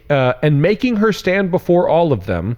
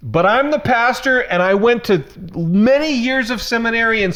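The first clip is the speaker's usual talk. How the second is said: worked-up rant, pitched higher throughout; climbing onto a soapbox and not getting off; 6 semitones higher than usual. Real, not acted. Pastor venting at Christians who miss the point, worked-up rant. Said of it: "All right, I'm off it".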